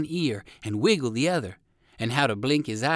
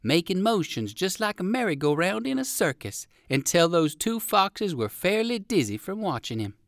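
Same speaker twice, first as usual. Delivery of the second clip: plain reading voice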